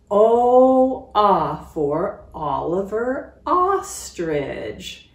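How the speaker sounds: loud O then slow chanted ah line; cheer. Shown as a demonstration. Teacher doing Oliver Ostrich's cheer, loud and slow. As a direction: shout the O, then chant it slowly. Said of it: "that's his cheer".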